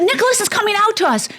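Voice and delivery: high, fast, breathless announcement; high urgent whisper-shout of news; excited urgent news. Retold or imitated. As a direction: blurt the news in a rush. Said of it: "I said".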